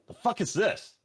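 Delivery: character voice. Acted. Player voices character reaction.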